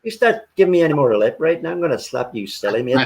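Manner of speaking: mock-threatening Northern Irish hard man; Northern Irish accent. Warning someone giving lip, Northern Irish accent. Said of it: "That Northern Irish accent is wicked".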